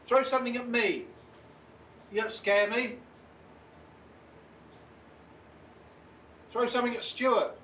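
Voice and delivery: high, called-out provocation; defiant taunting. Real, not acted. Investigator dares spirit to throw something, taunting high call. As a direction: goad the unseen into acting.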